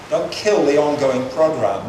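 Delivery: ordinary talk, nothing marked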